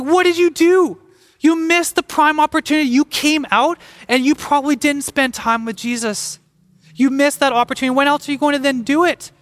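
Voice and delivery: high-pitched, incredulous telling-off; exasperated scolding. Acted. Preacher to people who missed their opportunity, exasperated.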